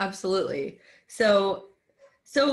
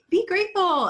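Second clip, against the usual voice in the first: high, louder mock advice; imitating preachy advice-giver; trite cheerleading; 12 semitones higher, 5 dB louder than usual. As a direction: chirp it like a pat platitude. Mimics trite advice to be grateful.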